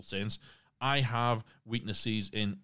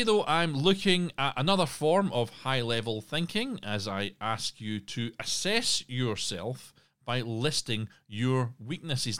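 normal talking voice

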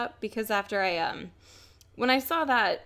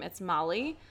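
usual speaking voice

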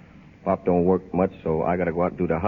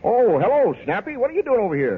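high, loud put-on brightness to a friend; forced cheer; 13 semitones higher, 3 dB louder than usual. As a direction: cover the sting with an overeager hello. Biff hides hurt behind greeting.

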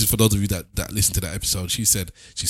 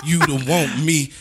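mock poet voice; 6 semitones higher, 3 dB louder than usual. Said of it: "all I'm thinking of is".

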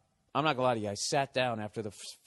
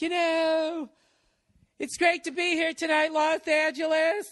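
much higher, loud, drawn-out diva voice; 7 dB louder, slower than usual. Retold or imitated. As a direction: ham up a pop star milking the crowd. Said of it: "doing that stupid dramatic pause to milk the crowd".

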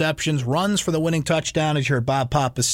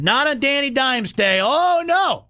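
gloating; 8 semitones higher than usual. Real, not acted. Gloating over the win.

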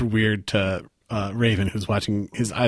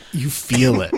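ordinary talk

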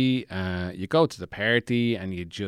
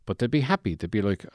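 ordinary talk, nothing marked

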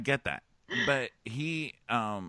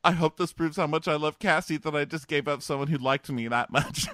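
higher-pitched mock heartfelt declaration; Colton; 5 semitones higher, 4 dB louder than usual. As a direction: imitate a self-pitying suitor, pitched up. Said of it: "he's like".

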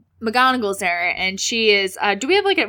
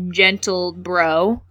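usual speaking voice

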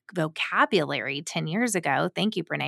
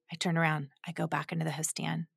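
ordinary talk, nothing marked

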